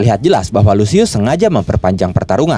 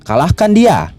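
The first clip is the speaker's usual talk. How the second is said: higher-pitched, restrained battle cry; mild loud call; 8 semitones higher, slower than usual. A narrator's reading. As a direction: urge them on with a mildly raised voice. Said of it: "berkata dengan lantang". (seruan lantang yang agak tertahan)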